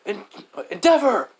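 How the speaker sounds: high, loud acted cry; urgent story-voice shout; calling out. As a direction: shout the name in alarm, pitch jumping up. Shoto calls out to Endeavor.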